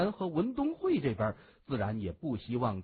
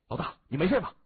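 louder, higher worried question; concerned call; 5 semitones higher, 5 dB louder, much faster than usual. A narrator's reading. Two henchmen asking their boss after the blast, anxiously. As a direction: call out anxiously to check on him.

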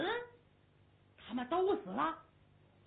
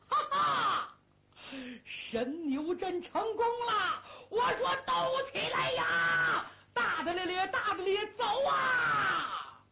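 triumphant shout; 8 semitones higher, slower than usual. Acted. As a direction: crow with victory at the troops. (胡大海得意大笑高喊)

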